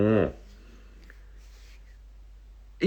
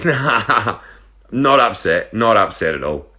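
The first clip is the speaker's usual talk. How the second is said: a laughed-out no; amused, drawn-out no through laughter; laughing denial; slower than usual. Real, not acted. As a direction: laugh while brushing it off. Laughing off a viewer's comment.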